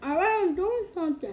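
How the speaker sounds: annoyed shout. Real, not acted. Kid snaps back at mother.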